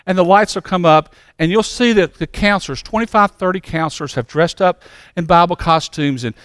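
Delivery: usual speaking voice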